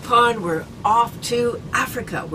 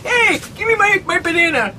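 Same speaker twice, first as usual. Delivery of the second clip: monkey; 7 semitones higher than usual. Pretend. Acting a monkey.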